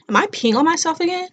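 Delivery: high, startled self-question; alarmed disbelief. Retold or imitated. Narrator retelling her water breaking, voice jumps high in alarm. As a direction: sound suddenly unsure of your own body. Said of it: "I'm like".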